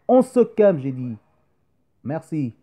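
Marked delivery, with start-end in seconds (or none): 0.1-0.8 higher, louder "on se calme" to hush the room; mock scolding call. Real, not acted. Host telling the boys in the audience to calm down, loud. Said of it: "calming the boys".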